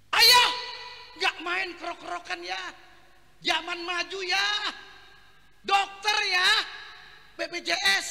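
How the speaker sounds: high, loud wife laying down the law; wife insisting her husband see a doctor; bossy wife. Acted. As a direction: tell him to see a doctor. Wife voiced in story.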